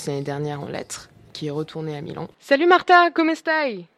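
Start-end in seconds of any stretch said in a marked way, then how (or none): 2.4-3.9 cheery greeting. Real, not acted. Greeting Italian guest on the phone.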